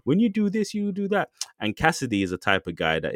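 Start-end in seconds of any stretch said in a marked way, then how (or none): 0.0-1.3 high, lightly fading mock talking-down; imitating the rappers lecturing, pitched well up. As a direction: parody them preaching at each other. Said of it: "they were all talking".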